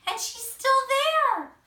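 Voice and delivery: high, loud, slow scared cub; frightened. A narrator's reading. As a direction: say it wide-eyed with fright. Baby Bear scared continued.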